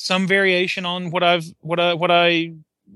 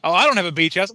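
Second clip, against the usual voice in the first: normal talking voice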